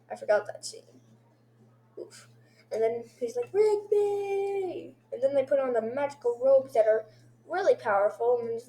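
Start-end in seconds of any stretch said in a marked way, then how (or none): 3.5-4.9 high, drawn-out cry of a name; imitated calling out. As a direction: call the name high, as in a retold scene. Voicing a character calling out.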